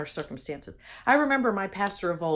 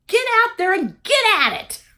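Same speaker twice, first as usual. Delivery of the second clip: much higher and louder rallying cry; rallying call; 11 semitones higher, 8 dB louder than usual. Real, not acted. Urging viewers to seize life, shouting higher and louder. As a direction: fire them up to go.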